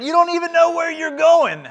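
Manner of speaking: high, loud disbelief; incredulous father. Acted. Father to son, high and loud in disbelief. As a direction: throw the objection back at him, high and loud.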